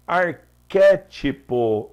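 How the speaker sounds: Arquétipo very slow and loud, stress on QUÉ; slow emphatic word. Shown as a demonstration. Teacher reading the word out slowly to the class. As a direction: draw out Arquétipo, leaning hard on QUÉ. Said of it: "outra proparoxítona".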